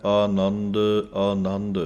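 ਆਨੰਦ with long open first vowel; ਆਨੰਦ ਕੰਨੇ ਨਾਲ; correct pronunciation. Shown as a demonstration. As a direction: sing ਆਨੰਦ with a clear long aa. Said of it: "ਐੜੇ ਨੂੰ ਕੰਨਾ ਹੈ".

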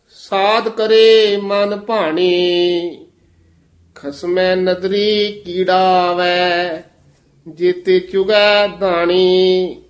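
slow scripture recitation, long held endings; Gurbani ਪਾਠ. Acted. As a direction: recite each phrase slowly, stretching the vowels. (ਹੌਲੀ, ਲਮਕਾ ਕੇ ਗੁਰਬਾਣੀ ਪਾਠ)

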